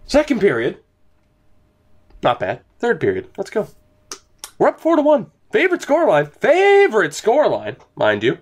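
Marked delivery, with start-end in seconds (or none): 4.6-7.8 hyped. Real, not acted. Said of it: "I got too hyped".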